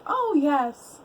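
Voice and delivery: high, delighted swoon of relief; retold relief. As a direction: greet the rescuer with playful relief. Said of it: "we felt relieved when Daniel showed up".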